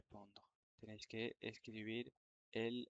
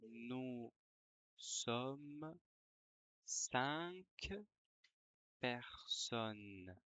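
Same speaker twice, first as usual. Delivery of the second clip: dictating. Shown as a demonstration. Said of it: "je vais dicter une dictée".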